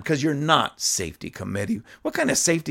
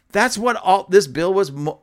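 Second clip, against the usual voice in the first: ordinary talk, nothing marked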